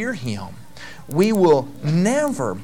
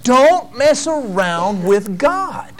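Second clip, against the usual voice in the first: slow, deliberate warning; preacher voicing David, slow and stern; 5 semitones higher, much slower than usual. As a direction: lay it down firmly as David. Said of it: "And David says".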